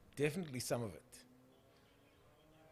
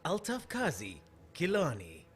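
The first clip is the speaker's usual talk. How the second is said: swooping TV reporter sign-off; imitated news sign-off with falling endings; Altaf Kazi impression; 3 dB louder, slower than usual. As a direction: mimic a reporter's stylised sing-out of a name. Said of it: "How does he say it".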